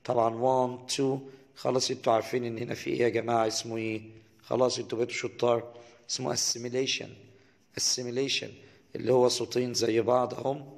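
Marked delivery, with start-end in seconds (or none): none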